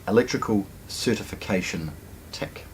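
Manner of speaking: ordinary talk, nothing marked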